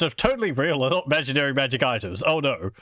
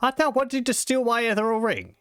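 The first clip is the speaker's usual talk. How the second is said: much higher, suspicious sultan voice; hammed-up suspicious nobleman, much higher; suspicious mad noble; 12 semitones higher than usual. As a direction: accuse the guest with mock suspicion. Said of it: "Martingo looks suspicious".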